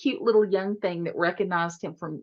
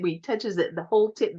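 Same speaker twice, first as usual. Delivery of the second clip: usual speaking voice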